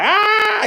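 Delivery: sudden high mock Beavis scream; hammed-up Beavis-style scream. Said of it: "like, screaming".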